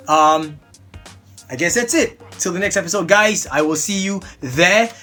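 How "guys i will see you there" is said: ordinary delivery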